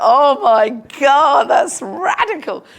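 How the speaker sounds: high, loud delighted cry, slowed right down; retelling a thrilled first reaction; excited exclamation. Retold or imitated. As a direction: burst out in wide-eyed delight. Retold excited outburst.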